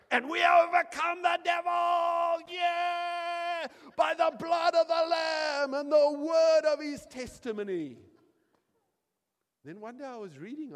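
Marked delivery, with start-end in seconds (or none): 0.1-8.0 very loud, high, hammed-up shouted declaration; old church shouting. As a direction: shout it like a fired-up congregation. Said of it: "They would say".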